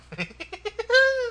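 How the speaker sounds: squeaky, high giggle; a thin high laugh at his own joke. Real, not acted. Amused by his own crude threat, high giggle.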